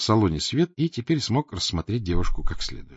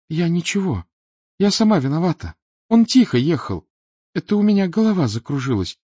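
put-on higher, apologetic young girl; girl's voice; 9 semitones higher, 5 dB louder than usual. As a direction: apologise shakily as a shaken teenager. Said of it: "обратилась она к ... и всхлипнула". (виноватый девичий голос)

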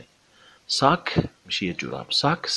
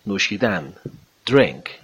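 ordinary talk

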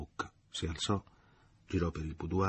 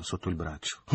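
ordinary delivery, nothing marked

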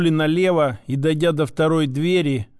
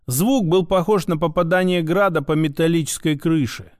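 ordinary delivery, nothing marked

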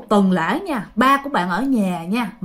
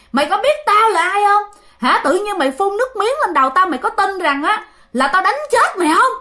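furious possessed shout; 9 semitones higher than usual. Retold or imitated. Possessed father yelling at the aunt who spat liquor, furious shout. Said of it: "Mà nói dữ thiệt là dữ luôn".

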